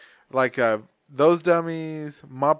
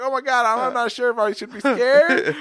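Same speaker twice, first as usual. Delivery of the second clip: high, louder mock fear; mock scared kid; 10 semitones higher, 7 dB louder, slower than usual. Child watching Sesame Street, mock scared rambling. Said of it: "I'm like".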